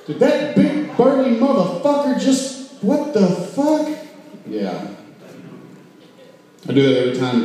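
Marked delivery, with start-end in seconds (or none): 0.1-3.9 put-on startled drunk. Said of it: "They're like".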